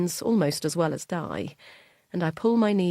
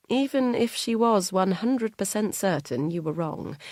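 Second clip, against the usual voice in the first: ordinary delivery, nothing marked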